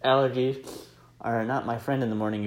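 normal talking voice

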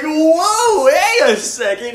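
imitating a stunned reaction while cracking up; mock surprise. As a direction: act shocked through laughter. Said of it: "So it was like".

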